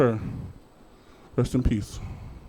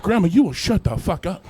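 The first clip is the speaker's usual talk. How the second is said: mock stern scold; 6 semitones higher, 5 dB louder than usual. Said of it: "I'm like".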